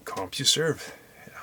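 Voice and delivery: whispered, questioning rise; quiet repeat. Real, not acted. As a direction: say it under your breath. Deflated after joke falls flat.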